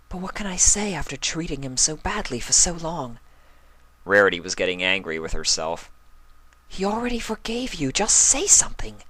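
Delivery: an elegant mare scolding herself; Rarity's voice. A narrator's reading.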